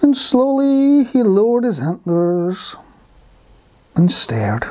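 lingering, sustained storytelling; slow narration. A narrator's reading. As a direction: stretch the words to match a snail. Narrator mimics the snail's slowness.

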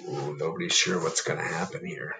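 usual speaking voice

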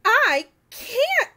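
high, loud protest. Acted. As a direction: blurt it out. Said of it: "started to say".

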